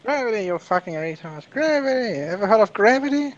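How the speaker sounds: hammed-up rant, pitch leaping on each exclamation; bad Desert File impression. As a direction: badly imitate a ranter, climbing to a high-pitched cry. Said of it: "maybe the worst Desert File impression ever".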